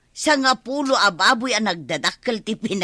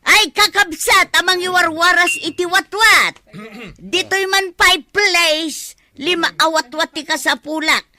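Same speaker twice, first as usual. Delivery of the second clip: calling out to everyone; 6 semitones higher, 5 dB louder than usual. Acted. Announcing to relatives at feast.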